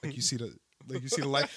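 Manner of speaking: quiet nervous murmur to a friend; hushed aside to Nick. Retold or imitated. Nervous passenger to friend on tilting plane, hushed. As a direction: lean over and ask under your breath. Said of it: "I'm like".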